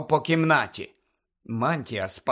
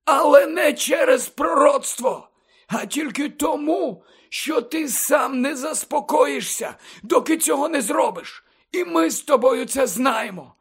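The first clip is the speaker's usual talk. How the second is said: much higher than narration, urgent mentor; Dumbledore's insistent persuasion; 11 semitones higher than usual. Acted. Headmaster asks pupil to imagine, insistent and earnest. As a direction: reason with the pupil emphatically.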